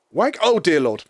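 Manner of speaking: usual speaking voice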